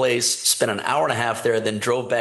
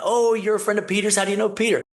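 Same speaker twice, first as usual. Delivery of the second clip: excited gushing; 9 semitones higher than usual. Retold or imitated. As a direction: voice jumps much higher, eager and delighted. Staff gushing over Peter's name.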